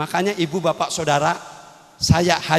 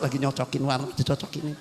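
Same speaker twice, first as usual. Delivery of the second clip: wife's soft reply; 5 semitones lower, 9 dB quieter than usual. Retold or imitated. Wife answers, quiet and low.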